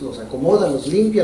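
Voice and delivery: ordinary talk, nothing marked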